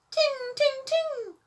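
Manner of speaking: very high, sung ding ding. A narrator's reading. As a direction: chime it like a tiny bell. The mystery bell sound.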